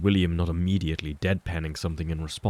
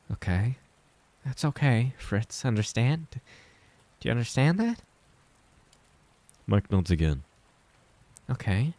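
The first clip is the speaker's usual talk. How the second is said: higher, gentle and insistent comfort; higher motherly reassurance with rising checks; reassuring mother; 6 semitones higher than usual. Acted. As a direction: soothe him, make him believe it. Clara reassures Mike.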